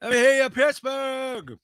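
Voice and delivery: high, loud arena shout; stage shout. Retold or imitated. Mock rock singer calling to the Pittsburgh crowd, high shout. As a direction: hype the crowd like a frontman.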